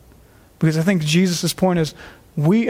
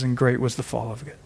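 much softer and lower, a heavy hush on "great"; sobering quote; 6 semitones lower, 6 dB quieter, faster than usual. Acted. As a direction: drop the voice low, a somber final warning. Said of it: "as Jesus says".

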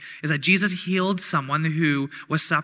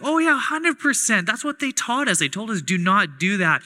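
cheerful co-leader; 5 semitones higher than usual. Retold or imitated. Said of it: "she's like".

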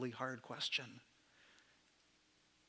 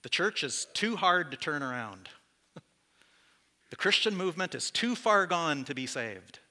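mock complaint to God, rising louder; griping to God, building to a louder whine; exasperated complaint; 2 semitones higher, 6 dB louder than usual. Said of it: "I just wanna complain and say".

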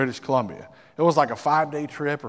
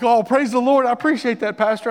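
grateful exclaim; 8 semitones higher, 6 dB louder than usual. Retold or imitated. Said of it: "I was like".

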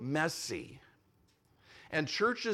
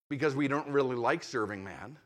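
ordinary delivery

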